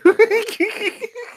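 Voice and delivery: high-pitched burst of laughter. Real, not acted. Friend cracks up at the pushing joke, high laugh. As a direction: let out a squeaky laugh.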